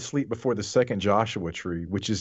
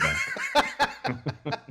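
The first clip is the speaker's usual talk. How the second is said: silly high voice; 4 dB quieter than usual. Acted.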